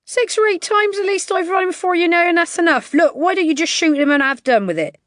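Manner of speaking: high, loud scornful bookie; mock the hopeless dog loudly. A narrator's reading.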